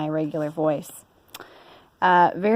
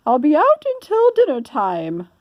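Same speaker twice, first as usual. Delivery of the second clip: high, louder fussy lady voice; Missus Rogers; 8 semitones higher, 5 dB louder than usual. Acted. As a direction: bustle like a busy mistress. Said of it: "said Missus Rogers".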